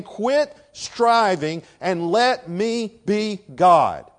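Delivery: slow, loud, weighty command; commanding divine voice. Acted. Preacher voicing God telling people to stop striving, slow and loud. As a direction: lay it down slowly as God's order. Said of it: "God is saying".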